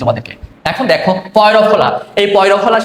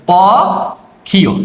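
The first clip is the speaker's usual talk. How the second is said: পক্ষীয় stretched very slowly; drawn out; much slower than usual. Shown as a demonstration. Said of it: "চলো এটা একটু ব্যাখ্যা করি". (পক্ষীয় টেনে টেনে)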